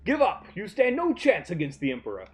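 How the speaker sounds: a hint of a menacing imperial commander; villain voice. Reads villain lines aloud.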